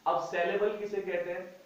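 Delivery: usual speaking voice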